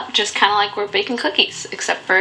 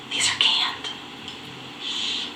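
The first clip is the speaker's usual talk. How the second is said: whisper; 9 dB quieter, slower than usual. Real, not acted. Confessing canned beets in aside.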